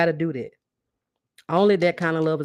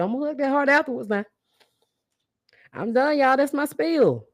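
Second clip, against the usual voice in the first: excited wrap-up; 9 semitones higher than usual. Real, not acted. Ending her pep talk excitedly.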